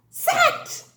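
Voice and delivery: "sat" in one high, excited burst; triumphant blending. Shown as a demonstration. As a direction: pop out "sat" high as one whole word. Sounds out word, then blends.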